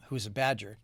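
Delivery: ordinary delivery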